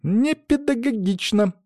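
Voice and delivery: high, drawn-out woman's rebuke; Lena's angry voice. A narrator's reading. Colleague scolding inside the teacher's head, angry. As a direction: stretch the word out in indignant high pitch. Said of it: "в голове прям звенит рассерженным голосом Лены".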